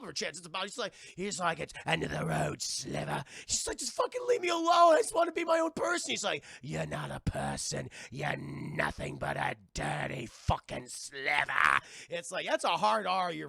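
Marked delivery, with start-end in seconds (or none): 1.6-3.2 slimy villain. Retold or imitated. Streamer impersonating Ben Mendelsohn greeting the heroine, low villain growl. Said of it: "slimy salamander-esque villain with a growl". 3.8-6.1 mock shout. Heroine screams at villain. 6.6-11.8 low, slow growling villain voice; slimy villain. Retold or imitated. Streamer impersonating Ben Mendelsohn greeting the heroine, low villain growl. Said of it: "slimy salamander-esque villain with a growl".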